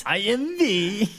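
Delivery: high, drawn-out mock Southern American accent; slow, badly faked Southern American accent, pitched high; V's Southern American accent. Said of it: "That's me doing V doing Southern American accent".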